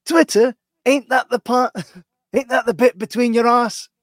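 high, loud put-on voice, laughing through it; mocking Henry's voice. Retelling a mate's crude joke at a gig, high loud mock voice. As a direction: squeak it out like a gobsmacked bloke. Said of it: "That's how he spoke".

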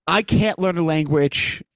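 usual speaking voice

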